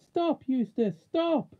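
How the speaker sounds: high, loud cry to stop; shouted plea, high and forceful; urgent cry. A narrator's reading. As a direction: call out sharply, pitched right up. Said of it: "cried Jill".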